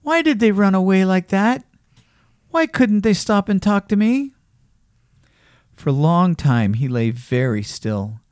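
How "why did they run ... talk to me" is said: forlorn rabbit. A narrator's reading. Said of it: "he thought".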